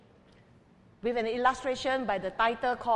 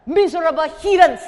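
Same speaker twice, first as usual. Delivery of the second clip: angry outburst; 6 semitones higher, 12 dB louder than usual. A narrator's reading. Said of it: "was angry and muttered to himself".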